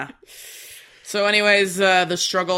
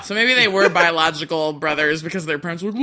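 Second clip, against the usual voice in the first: usual speaking voice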